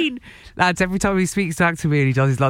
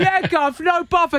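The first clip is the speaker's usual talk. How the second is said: put-on London accent; 13 semitones higher than usual. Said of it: "London accent".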